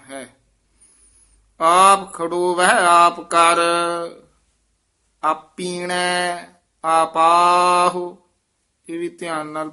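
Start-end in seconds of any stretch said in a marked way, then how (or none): 1.6-8.2 slow, measured chant of scripture; recited gurbani line. Shown as a demonstration. Teacher reciting scripture for students, measured chant.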